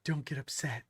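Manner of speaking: softly imitating someone calming him down; hushed, placating quote; mock calming. Quotes others trying to calm him.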